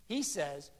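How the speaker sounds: normal talking voice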